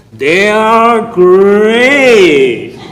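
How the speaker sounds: booming, very drawn-out cartoon mascot roar; Tony the Tiger. Comedian imitates the cereal commercial tiger, booming drawn-out slogan. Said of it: "Tony the Tiger would come on and say".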